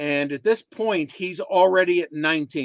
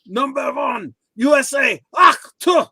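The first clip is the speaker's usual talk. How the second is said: higher, building to a loud shout on "ach, tu"; Iron Sheik impression; 9 semitones higher, 6 dB louder than usual. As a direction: bellow it like a wrestling heel on the mic. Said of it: "He would go".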